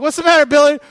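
high, loud, put-on worried voice; mock concern. Pastor acting himself checking on Billy, high and loud. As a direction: call out to the hurt boy. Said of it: "It's like".

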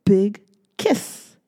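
plain reading voice

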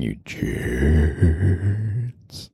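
"jerks" held long and playfully; drawn-out sign-off. Pretend. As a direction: stretch JERKS out very long in a mock jeer. Joking drawn-out sign-off to listeners.

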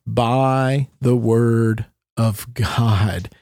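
"by the word of God" drawn out very slowly, word by word; stress on "by the word of God". Real, not acted. Co-host reading Hebrews to prove creation by speech, slow emphasis on "by the word of God". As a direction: stretch "by" and "God" long for emphasis. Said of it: "where I put emphasis".

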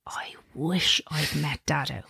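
slow, soft whisper. Retold or imitated. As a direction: breathe the child's wish. Son's wish quoted softly.

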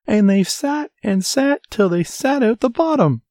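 tiny, shrill, very high bear voice; squeaky baby bear. A narrator's reading. Baby bear whose chair is broken, high shrill squeak. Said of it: "squeaked the baby bear in his shrill little voice".

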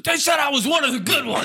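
high, shouted blurt of the line; heated outburst. Retold or imitated. Comedian getting heated while roasted by friends, shouting. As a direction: let it burst out angrily, way up high. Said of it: "and then he goes".

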